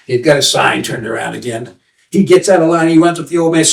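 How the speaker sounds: ordinary delivery, nothing marked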